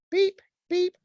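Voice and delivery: high-pitched imitated scanner beeps; squeaky electronic beep, done by mouth; robot beeps. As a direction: beep like a malfunctioning machine. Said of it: "It's a malfunctioning robot".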